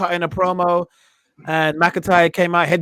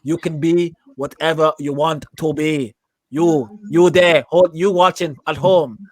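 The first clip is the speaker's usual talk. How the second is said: put-on slow passionate promo in a half-done accent; botched Cesaro impression. Host imitating Cesaro's promo for cohosts, a wobbly put-on accent. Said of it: "I'm not good at impressions".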